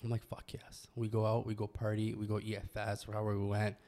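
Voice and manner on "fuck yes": breathy whispered fuck yes; hushed eager whisper. Retold or imitated. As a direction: whisper it like a secret thrill.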